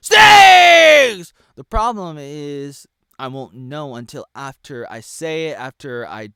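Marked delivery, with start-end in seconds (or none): 0.1-1.3 yelled, much higher and louder; mock shout. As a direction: blurt out the word in mock defiance. Shouting a banned word.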